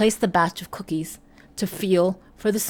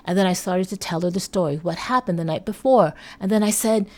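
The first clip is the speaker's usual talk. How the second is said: ordinary talk, nothing marked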